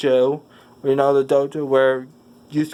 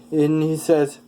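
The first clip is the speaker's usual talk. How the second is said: ordinary delivery, nothing marked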